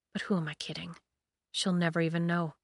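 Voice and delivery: unvoiced bitter whisper. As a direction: breathe it out, hopeless. Despairing aside.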